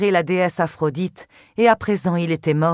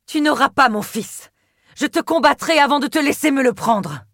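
fierce defiance; 8 semitones higher than usual. Acted. Mother defies goddess with spear.

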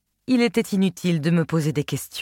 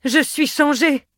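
elated cry; 8 semitones higher, 4 dB louder than usual. A narrator's reading. God rejoicing at transformation.

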